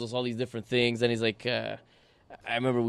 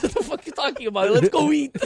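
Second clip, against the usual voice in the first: breezy dismissal; 12 semitones higher, 9 dB louder than usual. Retold or imitated. Driver dismisses worry, voiced.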